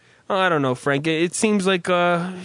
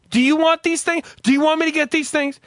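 higher, louder mock threat; imitated fed-up wife; 10 semitones higher, 4 dB louder than usual. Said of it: "She'll get to the point where she goes".

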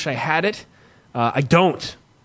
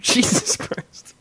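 slow unvoiced whisper; 8 semitones higher, much slower than usual. Real, not acted. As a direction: breathe it out in disbelief. Reacting to the host giving up.

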